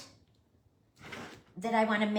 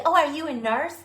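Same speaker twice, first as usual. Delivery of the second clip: mock surprise; 7 semitones higher, 8 dB louder than usual. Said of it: "I'm like".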